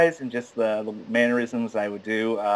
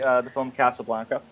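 ordinary talk

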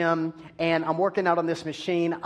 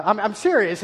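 usual speaking voice

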